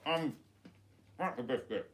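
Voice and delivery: chewing while talking; words muffled by food; mouth full. Real, not acted. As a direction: say it round a mouthful of biscuit. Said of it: "who's talking with his mouth full".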